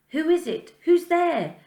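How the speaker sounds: high, loud frightened call; scared child calling out. Acted. A child hiding in a bush calling out to a stranger, scared. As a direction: call out, afraid of who's there.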